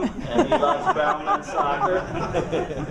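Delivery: laughing through the words; chuckling while speaking. Real, not acted. Joking about biased announcer.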